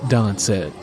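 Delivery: usual speaking voice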